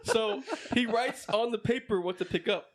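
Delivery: ordinary delivery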